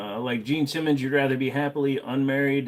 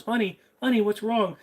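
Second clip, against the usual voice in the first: higher pleading wife voice; worried wife; 12 semitones higher than usual. Acted.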